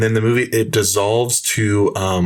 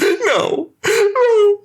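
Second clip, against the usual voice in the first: high-pitched melodramatic wail; hammed-up sobbing cry; mock screaming and crying; 3 dB louder than usual. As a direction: howl it in exaggerated movie grief. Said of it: "She starts screaming and crying".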